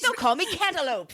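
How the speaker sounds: high, put-on whine; whiny joke voice. Joking about a new witness name, high whiny voice. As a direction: whine it like a sulky kid.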